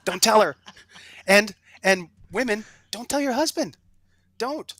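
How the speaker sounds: high, playful warning; mock warning. Real, not acted. Guest joking to golfers about hiding costs, playful. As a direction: warn them with a wink.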